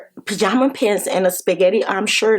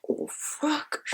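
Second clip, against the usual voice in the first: whispered oh fuck; 6 semitones higher, 14 dB quieter than usual. Retold or imitated. Shocked reaction to the dream.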